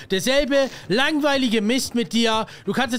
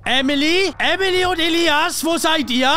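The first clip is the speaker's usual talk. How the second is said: loud, higher calling through the flat; 6 semitones higher, 5 dB louder than usual. Acted. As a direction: call out for the missing kids. Ruft Kinder durch die Wohnung. (laut rufend durch die Wohnung)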